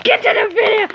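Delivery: high, impatient yell at the others; impatient high call. Real, not acted. Kid yelling at mom to start the video, impatient high shout.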